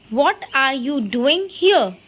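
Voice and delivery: slow example reading. Shown as a demonstration. Teacher slowly reads the example question to the class. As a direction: read the question out slowly and evenly.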